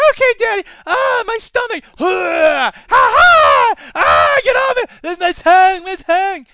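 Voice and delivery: high, loud hammed-up kid shrieking in pain; child screaming in pain. Timmy shrieking to Daddy as the plutonium dirt burns him, hammed-up high child voice. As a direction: scream like a poisoned little boy.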